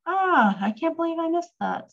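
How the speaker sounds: high, quick dismay at a slip; real self-reproach. Real, not acted. Teacher to students after missing a word, dismayed at herself. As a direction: exclaim high, annoyed at yourself. Teacher notices her own mistake.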